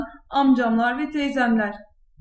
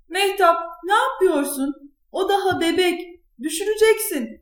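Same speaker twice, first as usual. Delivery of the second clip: high-pitched, alarmed telling-off; angry scolding; 6 semitones higher than usual. A narrator's reading. Mother catches daughter spinning the baby, angry scolding. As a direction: scold the child in a raised pitch. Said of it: "Bayağı kızmıştı".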